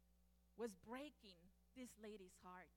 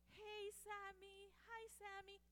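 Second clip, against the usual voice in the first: put-on tender baby talk; 7 semitones higher, slower than usual. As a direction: coo gently to the baby. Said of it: "he said".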